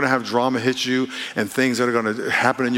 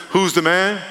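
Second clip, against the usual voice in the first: call-out; 6 semitones higher than usual. Shown as a demonstration. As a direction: call out "Who's the man?" higher and rising. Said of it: "So I'm gonna say it. Let's practice it".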